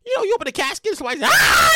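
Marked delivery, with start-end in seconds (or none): 1.3-1.7 high, loud startled scream; a sudden scream of fright; high shriek of terror. Acted. Person in casket screams.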